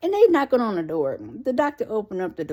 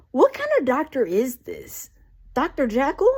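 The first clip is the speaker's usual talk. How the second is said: incredulous; 5 semitones higher than usual. Real, not acted. Baffled aside on the creepy doctor.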